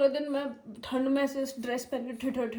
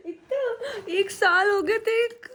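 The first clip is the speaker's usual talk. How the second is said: laughing and teary; 8 semitones higher, 5 dB louder than usual. Real, not acted. Emotional over pet's year.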